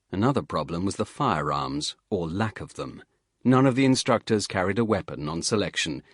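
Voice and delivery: ordinary delivery, nothing marked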